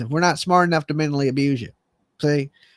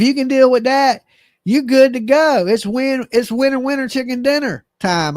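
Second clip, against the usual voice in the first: excited pitch; 7 semitones higher than usual. Acted. Excited punchline.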